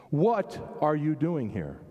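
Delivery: WHAT stretched out slowly, the rest following; stress on WHAT. Shown as a demonstration. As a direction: lean hard and slow on "What". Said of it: "put the emphasis on a different place".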